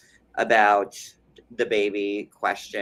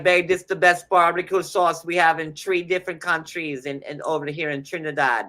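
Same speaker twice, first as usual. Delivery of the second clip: put-on channel a Trinidadian accent as the wife; amateur Trinidadian accent impression; 5 semitones higher than usual. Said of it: "I never tried to Trinidadian accent before, but I'm trying to channel it".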